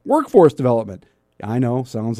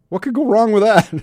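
sarcastic; 9 semitones higher, 5 dB louder than usual. Real, not acted. Joking about dangerous snow caves.

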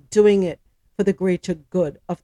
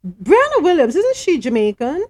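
higher, louder double take on the name; surprised question; 5 semitones higher, 5 dB louder than usual. Real, not acted. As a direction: break off reading to check, surprised. Stops reading, questions a familiar name.

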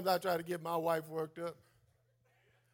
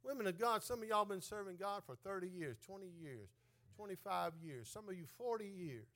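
soft appeal; 10 dB quieter than usual. Acted. Soft appeal before climax.

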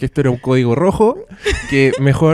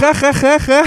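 mock laugh; 12 semitones higher, 4 dB louder, slower than usual. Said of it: "mock laugh spelled out".

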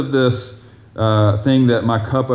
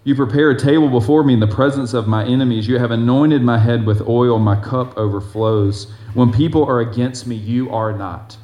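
loud, declaiming psalm reading; scripture reading; 5 dB louder than usual. Acted. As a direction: proclaim the verse to the congregation. Reads next verse aloud.

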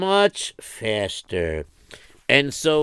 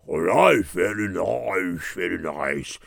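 lower, slow, soft teacher's drone; old melamed; 7 semitones lower, 5 dB quieter than usual. Retold or imitated. Melamed greeting the boy.

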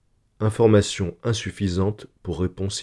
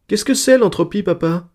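put-on high-pitched child's question; little girl; 11 semitones higher, 4 dB louder than usual. A narrator's reading. Little daughter asking papa about entropy, child's voice. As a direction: pipe it up as a curious small daughter. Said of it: "dit Gérodette deux".

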